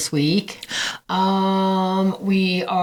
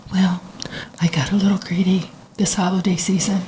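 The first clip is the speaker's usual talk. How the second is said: whisper. Retold or imitated. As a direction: whisper it like ASMR. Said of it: "she's going".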